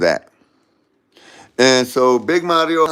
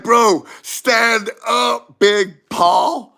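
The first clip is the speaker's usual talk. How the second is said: drawn-out, raised-pitch yell; loud, stretched-out plea; exasperated calling out; 7 semitones higher, 3 dB louder, much slower than usual. Retold or imitated. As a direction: call slowly to a floundering teammate. Yelling at a struggling teammate.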